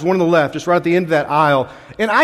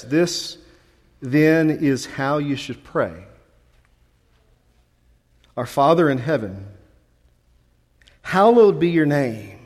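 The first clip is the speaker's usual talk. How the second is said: hushed Lord's Prayer recitation; scripture reading; 4 semitones lower, 3 dB quieter than usual. Acted.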